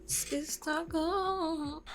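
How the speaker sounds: ordinary delivery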